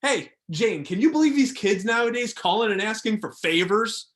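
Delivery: put-on a fed-up old coach griping to his wife about kids today; grumbling coach to Jane. Coach complaining to his wife about the call, loudly indignant.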